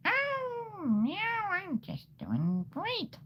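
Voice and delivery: very high, slow, sugary voice; overly sweet and fake cheerful; squeaky sing-song reply; chipper character. Character answering in story.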